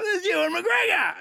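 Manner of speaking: high, slow excited yell; excited shout. Retold or imitated. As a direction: shout it out like a thrilled crowd. Pub crowd spots celebrity.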